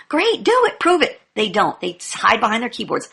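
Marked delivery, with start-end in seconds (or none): 0.1-1.2 taunting challenge. Real, not acted. Challenging the mgtows.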